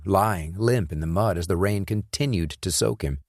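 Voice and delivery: ordinary talk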